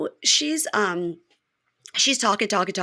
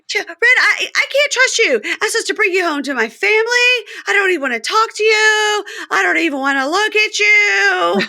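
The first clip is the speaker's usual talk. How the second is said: much higher, louder mock rant; voicing Joy ranting; 13 semitones higher, 4 dB louder than usual. Podcaster as Joy ranting at Red, high and loud. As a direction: fire off jealous accusations.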